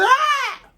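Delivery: very high, loud shriek; mock scream. Nami grabs the burning fuse, high acted scream. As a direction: let out a piercing acted scream. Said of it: "And she screams".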